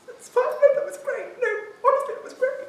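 very high, choked reassurance; hammed-up high teary voice insisting it was great; mock tearful singer. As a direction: squeak it out through pretend tears. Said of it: "they get to the".

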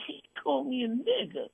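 high, soft whiny mock of a complainer; high soft mock complaint. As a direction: whine it like a tattletale. Mocking offended complainer.

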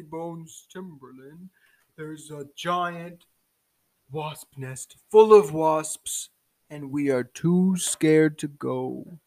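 slow, deliberating monarch; giant turtle king. Acted. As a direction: ponder aloud as a ponderous turtle king. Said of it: "the giant turtle king".

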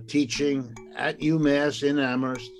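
ordinary talk

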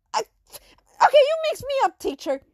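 loud, high, halting whine; flustered complaint. Acted. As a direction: wail that it's all too confusing. Flustered pupil blames the teacher.

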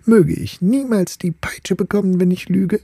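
high, earnest voice swearing an oath; earnest vow. Acted. The top swearing to the ball he never lies, high and earnest. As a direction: swear it like a wounded suitor.

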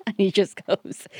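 usual speaking voice

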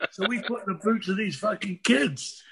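ordinary talk, nothing marked

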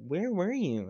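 high indignant question; playing Amber annoyed; mock angry Amber. Amber's angry retort retold.

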